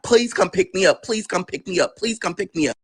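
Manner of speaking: high, repeated urgent begging; retold frantic plea; desperate pleading. Pleading phone call to aunt.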